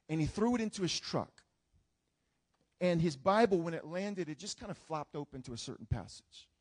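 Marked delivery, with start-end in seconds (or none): none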